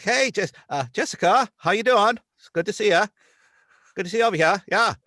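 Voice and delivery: folksy Maine accent, high and loud; Maine deputy accent. Shown as a demonstration. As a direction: greet Jessica like a small-town Maine deputy. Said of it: "let me see if I could do it now".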